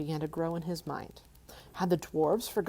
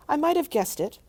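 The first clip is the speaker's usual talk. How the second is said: higher, louder flattering hobbit; butter up the dragon; 10 semitones higher, 7 dB louder than usual. Acted. Bilbo flattering.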